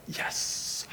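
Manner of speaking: drawn-out whispered "yesss"; quiet, voiceless little celebration; hushed yes. Acted. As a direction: breathe out a long, hissing yes. Mock response to troubles.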